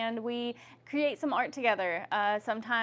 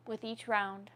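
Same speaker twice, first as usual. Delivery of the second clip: yoga instruction. Acted. Leads yoga neck movements.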